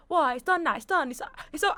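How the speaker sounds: high, dismissive imitation; mock unapologetic. Imitating the housemate refusing to apologise, high and dismissive. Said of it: "he was not apologetic".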